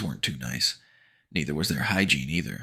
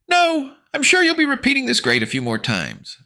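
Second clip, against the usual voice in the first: high, loud laughing jab; laughing taunt; 6 dB louder than usual. Acted. Narrator laughing at the bullies while running off. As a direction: laugh it at them. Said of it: "I laugh".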